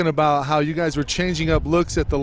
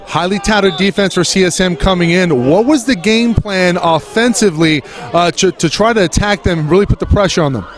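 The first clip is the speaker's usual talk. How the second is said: loud sideline interview over crowd; breathless sideline reporter; 10 semitones higher, 19 dB louder than usual. Real, not acted. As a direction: shout it over the stadium noise.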